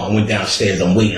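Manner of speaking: ordinary delivery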